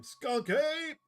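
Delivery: loud, high, rising shout; excited cry. Acted. Player calling out the skunk ape, excited cry.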